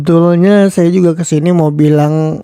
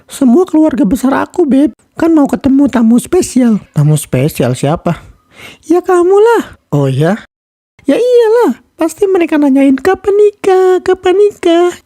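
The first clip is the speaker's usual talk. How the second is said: put-on girlfriend voice; 10 semitones higher than usual. Girlfriend role.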